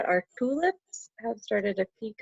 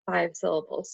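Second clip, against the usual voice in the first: usual speaking voice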